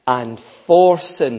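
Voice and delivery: "for sin" slow and loud; emphatic phrase. Shown as a demonstration. Preacher pointing listeners to two words, "for sin" slow and emphatic. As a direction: draw out "for sin" with weight. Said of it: "Notice those two words".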